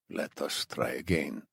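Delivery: put-on low, soft Iranian-accented menace; hushed, patient captor; accented interrogator. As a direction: quietly restart the interrogation, thick accent. Captor resumes interrogation, narrator voicing.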